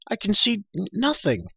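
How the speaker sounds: crying out. A narrator's reading. Prisoner blinded by the dark to the girl, crying out. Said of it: "cried Taryn".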